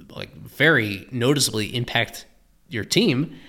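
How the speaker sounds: ordinary talk, nothing marked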